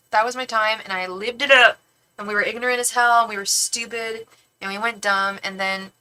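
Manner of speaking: usual speaking voice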